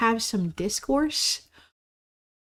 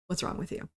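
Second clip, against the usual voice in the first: whisper; 10 dB quieter than usual. Real, not acted. Scolding Susan.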